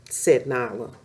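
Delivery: usual speaking voice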